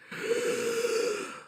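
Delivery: high wheezing intake of breath after laughing; laughing gasp. Real, not acted. Man recovering from his joke about TV execs, high wheezy gasp. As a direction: gasp for air, helpless with laughter.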